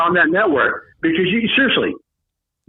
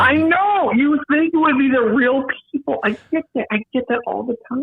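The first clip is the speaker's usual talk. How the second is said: put-on voice; 10 semitones higher than usual. After impression talk, voice changes.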